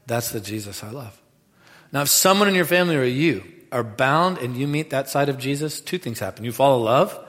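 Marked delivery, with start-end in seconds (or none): 0.1-1.2 soft, low restatement; quiet, tender repeat. Acted. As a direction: bring it right down and mean it. Quiet repeat of earlier cry.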